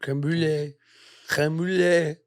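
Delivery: put-on French accent. French pronunciation of creme brulee.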